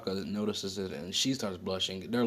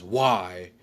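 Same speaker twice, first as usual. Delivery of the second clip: exploding, much louder "Why?"; loud outburst; 10 dB louder than usual. Retold or imitated. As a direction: blurt it out in a loud burst. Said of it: "in a really loud".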